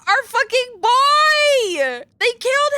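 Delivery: high, loud, very slow wail; grief-stricken wail. Real, not acted. Gamer mourns the murdered boy, wailing. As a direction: howl out the loss.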